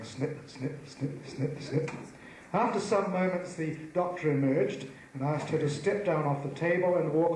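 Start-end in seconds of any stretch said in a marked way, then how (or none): 0.0-1.9 soft, crisp imitated snips; snipping scissors. Doctor cutting under the skirt, soft snipping. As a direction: make soft scissor noises with the word.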